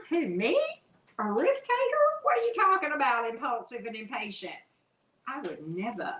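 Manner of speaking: playful fake innocence; mock innocent. Feigned innocence about being impulsive.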